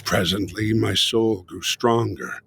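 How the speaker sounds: plain reading voice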